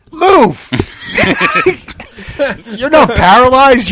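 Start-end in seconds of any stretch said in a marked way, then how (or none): 0.1-0.7 high, loud, single-word shout; exasperated yell. Retold or imitated. Yelling at the girl on screen to move, exasperated. 0.8-2.7 a high, shouted burst of laughter. Real, not acted. Laughing at the scene.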